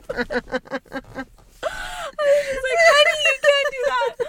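high, loud, laughing through the words; can barely get it out for laughing; laughing while speaking. Real, not acted. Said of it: "couldn't help but just laugh".

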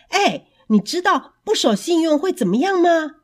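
loud. Acted. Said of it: "他大声地说".

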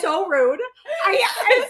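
high, loud laughing protest; laughing complaint. Real, not acted. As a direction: complain while helpless with laughter. Mock complaint to friend, cracking up.

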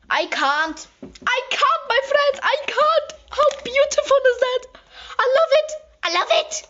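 very high, loud English gushing; gushing squeal. Real, not acted. Telling her friends she loves the palette, high gushing squeal. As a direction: squeal like you can't contain yourself.

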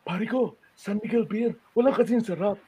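a hint of a singer's crooned ad line; lightly voiced commercial pitch as Rico J. Shown as a demonstration. Voice actor demoing his Rico J dub, lightly crooned. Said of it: "I would do the".

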